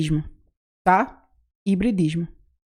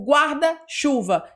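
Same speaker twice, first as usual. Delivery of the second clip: slow demo; 2 semitones higher, much slower than usual. Shown as a demonstration. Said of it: "Quando eu falo, por exemplo".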